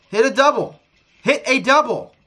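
will it to hit a double; urgent plea. Real, not acted. Streamer urging the roulette wheel to hit, excited plea.

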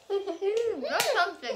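slow laugh through the words. Real, not acted. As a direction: laugh it out slowly. Reacting to tasting cold knish.

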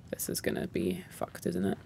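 hushed, scared whisper to the viewers. Real, not acted. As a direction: breathe it out without voice, uneasy. Scared aside in creepy game.

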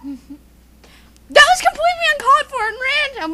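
high, loud indignant outburst; outraged protest. Acted. Objecting to an uncalled-for random bit, outraged protest. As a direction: protest loudly in mock outrage.

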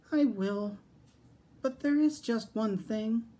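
high little piglet voice; piglet giving in. A narrator's reading. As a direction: pitch it high, a small pig stalling politely. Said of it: "sighed the piglet".

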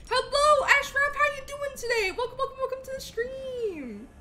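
high, loud, bubbly thank-you shout-out; bright high-pitched streamer welcome voice; excited streamer greeting. Acted. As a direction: hype up the new follower. Greets a viewer in chat.

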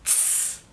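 "Tss" as a soft voiceless ts with a stream of air; exhaled C. Shown as a demonstration. Teacher showing how to exhale, "Tss" hissed softly without voice. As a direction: puff air through "Tss". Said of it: "exhalar el aire".

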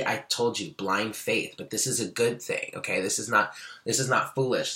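ordinary delivery

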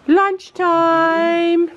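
high sing-song call. Real, not acted. Vlogger calls the horses in for lunch. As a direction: call Lunchtime across the field.

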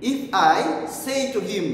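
usual speaking voice

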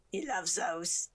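lightly put-on odd voice; a hint of a silly figure; odd character voice. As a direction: do an improvised character voice. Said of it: "I don't know what these voices are".

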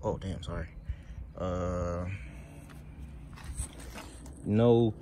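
ordinary talk

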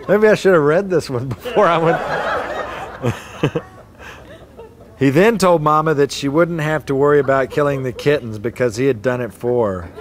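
ordinary delivery, nothing marked

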